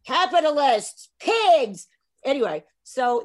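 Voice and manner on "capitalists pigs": loud and high like a chanted slogan; hammed-up denunciation of capitalists; mock socialist shout, higher and louder; mock communist slogan. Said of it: "then it's like".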